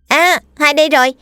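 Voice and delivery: put-on boy asking his mother where sister is, high childlike voice; little boy voice. A narrator's reading. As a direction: voice the young son, high and light. Small boy speaking to mother.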